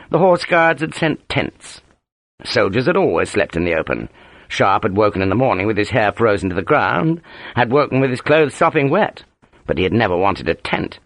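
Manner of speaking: ordinary delivery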